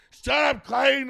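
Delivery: high, loud scolding shout; imitated mom yelling. Mom yells at her son from the other room, mock scolding shout. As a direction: holler it across the house like a fed-up mom.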